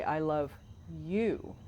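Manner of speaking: "I love", a pause, then "you" on its own; stress on you. Shown as a demonstration. Host checking cohost's reading, stress on "you". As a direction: hold back, then place "you" by itself. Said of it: "the way you're reading that".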